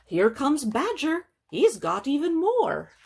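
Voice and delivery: high, loud storybook hare; excited hare. Acted. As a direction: announce it high and eager as an animal character. Hare announces Badger's arrival.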